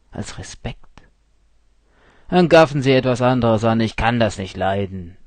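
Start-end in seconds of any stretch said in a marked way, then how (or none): 2.3-5.2 loud, fast, annoyed rebuke; irritated snap. A narrator's reading. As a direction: bark at a stranger who stares. Steuermann snaps at staring narrator.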